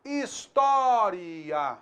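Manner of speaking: História very slowly, ending split into ri-a; História with ri-a as separate syllables; regional hiatus pronunciation. Shown as a demonstration. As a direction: drag out História with the final vowels apart. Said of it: "faz como?".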